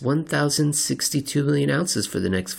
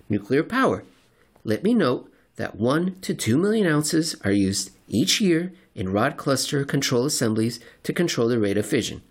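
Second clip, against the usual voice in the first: usual speaking voice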